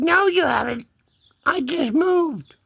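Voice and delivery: high put-on kitty retort; cat voice. Cat denying he woke, high cat voice. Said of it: "He said".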